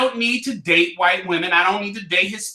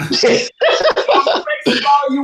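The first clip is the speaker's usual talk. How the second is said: 1 laughing; 6 semitones higher, 4 dB louder, much slower than usual. Real, not acted. Laughs off the racism jab.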